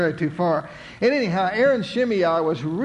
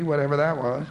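plain reading voice

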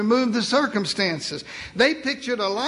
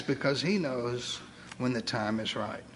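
normal talking voice

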